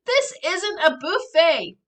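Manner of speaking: high, loud, slow shriek. Acted. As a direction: shriek at him in disbelief. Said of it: "I shriek".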